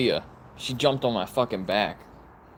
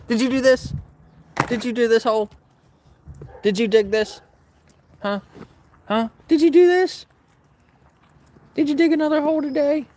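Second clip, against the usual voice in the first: mock interrogation; 12 semitones higher, 4 dB louder than usual. Real, not acted. Owner grilling dog about the hole, high baby talk. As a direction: press the dog, climbing to a squeal.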